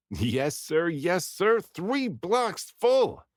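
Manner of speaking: gleeful chanted cheer; sing-song cheer. Acted. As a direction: chant it in triumph like a nursery rhyme. Cheering after shearing the sheep.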